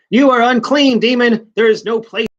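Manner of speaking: hammed-up righteous heroine; mock dramatic heroine. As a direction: declaim it like a comic-book sorceress. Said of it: "Zatanna says".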